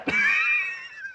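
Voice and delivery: high loud shriek of laughter. Real, not acted. Laugh-shout after prank.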